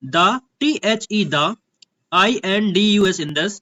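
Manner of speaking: slow, letter by letter, then the word; childlike spelling-out. Shown as a demonstration. Teacher shows students how not to read, spelling it out childishly. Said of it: "बच्चों की तरह मत पढ़ना".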